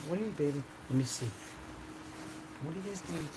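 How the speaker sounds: breathy murmur to a puppy. Real, not acted. Cooing to the dog.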